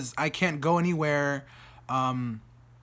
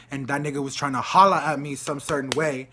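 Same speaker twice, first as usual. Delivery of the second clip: usual speaking voice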